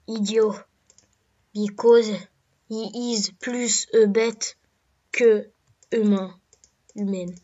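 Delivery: put-on halting English with a heavy French accent; mock English with a thick French accent; a self-mocking French-accented English; bad English accent. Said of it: "Excusez-moi pour mon accent de, de nul".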